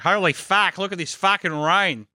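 exclaiming Australian big rig driver; Australian trucker. Acted. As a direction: gripe over the CB in an Australian accent. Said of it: "every Australian big rig driver".

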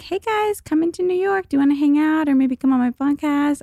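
high, lilting imitation of an eager message; perky retold invite. Texting two comedians to hang out, perky and high. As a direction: read it out in a high, mock-casual pitch. Re-enacting own hopeful group message.

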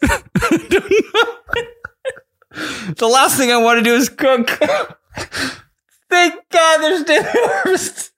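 high, slow, squeaky laughing; can't stop laughing, tears coming; crying with laughter. Real, not acted. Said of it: "Are you crying?".

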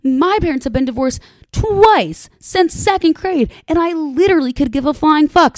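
high, loud mocking brag; mocking teen self. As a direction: toss it off like a bratty teenager. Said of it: "I was always like".

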